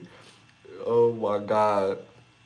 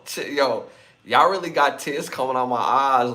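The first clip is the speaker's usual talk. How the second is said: usual speaking voice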